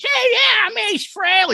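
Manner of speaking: high-pitched mock rock star voice. Host retells a guest suddenly imitating the guitarist, high impression. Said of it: "He started doing an impression".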